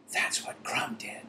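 hushed, voiceless whisper. A narrator's reading. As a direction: whisper it so nobody else hears. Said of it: "Hermione whispered".

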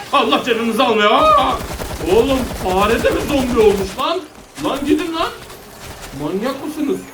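high-pitched, alarmed scolding; startled outburst. Real, not acted. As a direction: curse them in a high voice after a sudden fright. Zombies suddenly startle the player. (irkilmiş, tiz sesle çıkışma)

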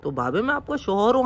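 ordinary talk

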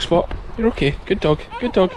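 ordinary talk

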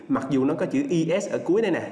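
normal talking voice

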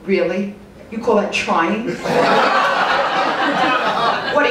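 ordinary delivery

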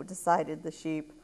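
ordinary delivery, nothing marked

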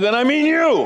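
high, loud gruff declaration; gruff old preacher. Retold or imitated. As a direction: settle it with blunt authority. Gruff preacher voiced in story.

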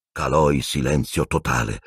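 ordinary delivery, nothing marked

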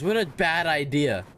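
usual speaking voice

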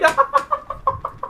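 airy squeal of laughter; high breathless laugh. Real, not acted. Laughs at absurd luck.

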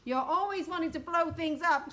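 high, loud, cross complaint. A narrator's reading. President scolding the general, cross. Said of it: "said the president crossly".

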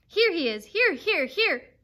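loud, high repeated yelps; yapping dog. A narrator's reading. Dog urging on someone far behind, high yapping. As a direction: call out like a frantic hound. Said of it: "he yapped".

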